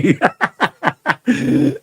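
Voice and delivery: a high, breathy laugh. Real, not acted. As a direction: laugh hard at your own story. Laugh alone on line. (mataas na tawa)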